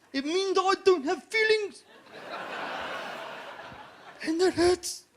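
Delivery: high-pitched, hurt Maori doorman; Maori accent. Acted. Big doorman protesting he has feelings, high Maori accent. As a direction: play an emotional big guy in a high Maori voice. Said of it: "you've got that Maori accent down pretty well".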